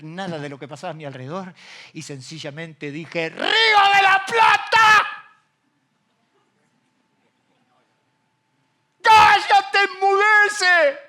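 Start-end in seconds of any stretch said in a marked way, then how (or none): 3.3-11.0 slow, much higher and louder rebuke; commanding shout. Retold or imitated. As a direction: command the river to be still. Retells rebuking the river. (grito de mando, lento y muy agudo)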